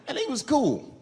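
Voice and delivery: usual speaking voice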